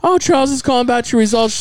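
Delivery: high, imitated teenager on a results call; teenage clinic caller. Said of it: "teenager calls me back".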